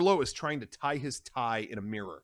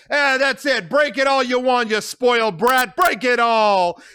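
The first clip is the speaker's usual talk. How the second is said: much higher, loud, taunting; jeering shout; 12 semitones higher, 11 dB louder than usual. Acted. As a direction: goad her to smash more. Carlo yells as she smashes dishes.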